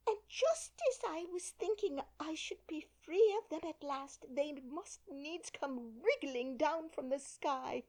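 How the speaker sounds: building to a high, loud, slow shriek. A narrator's reading. Pigeon shrieks at Alice. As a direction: wail "Oh, serpent!" at the top. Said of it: "raising its voice to a shriek".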